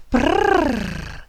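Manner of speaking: high, loud shivery brrr; shivering brrr. A narrator's reading. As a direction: shiver from the cold. Cold in the story.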